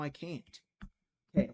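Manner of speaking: ordinary talk, nothing marked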